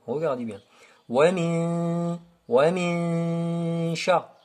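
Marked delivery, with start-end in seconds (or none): 1.1-4.4 "min" held long, gliding straight into "sha"; nasal ghunna. Shown as a demonstration. Teacher shows passage to shin, hummed "min". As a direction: sustain the hum of "min" without a clear n. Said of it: "Directement au sh".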